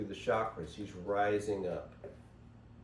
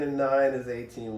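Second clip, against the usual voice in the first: usual speaking voice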